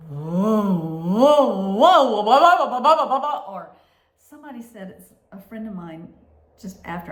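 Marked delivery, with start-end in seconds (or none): 0.1-3.5 loud swooping whoas climbing into rapid pulses; imitated MRI noise. Patient mimicking the weird MRI sounds, loud rising whoops. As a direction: imitate a blaring machine, rising and speeding up. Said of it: "some other weirdo sound would start up".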